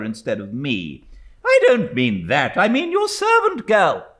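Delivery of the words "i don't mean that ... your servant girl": loud, higher, impatient correction; impatient old aunt. A narrator's reading. Stern aunt correcting the widow, impatient. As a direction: correct her sharply.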